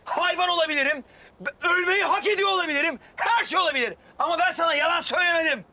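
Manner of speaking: loud pleading yell; shouting. Acted. Man shouting a desperate plea at Sedef. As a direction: shout to make her listen. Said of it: "Ne bağırıyorsun?".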